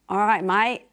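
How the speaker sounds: a cheery attempt at an Australian accent; playful mock Australian accent. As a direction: lay on the Australian twang, a bit hammed-up. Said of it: "do it in your best Australian accent".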